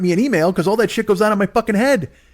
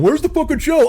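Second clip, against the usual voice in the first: mock angry yell; 10 semitones higher than usual. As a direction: bark out the demand like an impatient fan. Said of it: "a fucking letter from somebody going".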